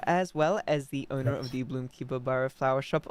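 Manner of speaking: normal talking voice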